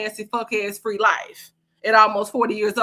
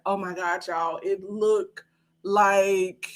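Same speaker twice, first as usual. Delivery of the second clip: mock scandalized viewer; 3 semitones lower, much slower than usual. Telling the chat what she saw, hushed and slow. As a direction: drag it out like you can't believe what you saw. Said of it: "and be like".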